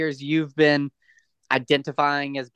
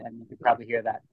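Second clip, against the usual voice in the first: chewing while talking; 4 semitones lower, 6 dB quieter, faster than usual. Real, not acted. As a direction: mouth full of brownie. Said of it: "I'm trying to eat a brownie as I have this conversation".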